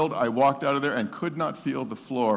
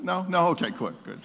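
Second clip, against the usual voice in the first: ordinary talk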